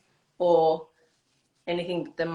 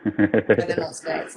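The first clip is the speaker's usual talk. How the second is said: much lower, slow, dry aside; deadpan joke; 12 semitones lower, slower than usual. Real, not acted. Skater admits her pillows aren't skates, deadpan. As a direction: drop the voice for a deadpan punchline.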